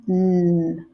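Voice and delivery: ordinary talk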